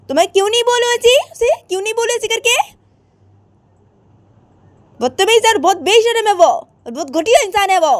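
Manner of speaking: high, loud and rushed; angry outburst. Acted. Radhika telling her mother to leave, angry high outburst. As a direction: tell mom off, fast and furious. Radhika raging about husband.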